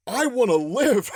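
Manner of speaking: high, drawn-out mock plea; hammed-up begging for life; desperate plea. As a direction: stretch it out high, pleading. Hunter begs for his life.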